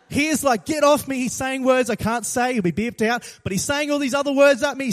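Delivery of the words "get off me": usual speaking voice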